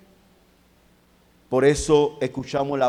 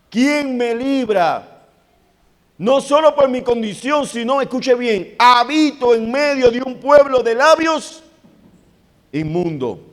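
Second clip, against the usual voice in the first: much higher and louder, desperate, pleading; prophet's anguished cry; 9 semitones higher, 8 dB louder than usual. Acted. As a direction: cry out like a man already in hell. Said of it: "el profeta está diciendo". (el profeta clama desesperado, agudo y fuerte)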